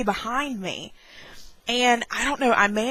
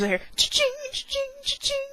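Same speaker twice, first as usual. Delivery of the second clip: cha-ching imitation; 6 dB quieter, faster than usual. Imitating a cash register noise.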